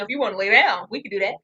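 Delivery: ordinary talk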